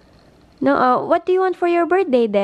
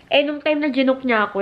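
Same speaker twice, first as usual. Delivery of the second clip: normal talking voice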